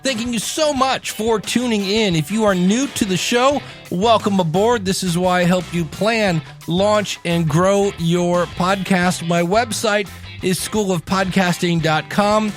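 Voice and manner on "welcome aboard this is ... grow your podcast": usual speaking voice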